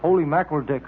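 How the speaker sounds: ordinary delivery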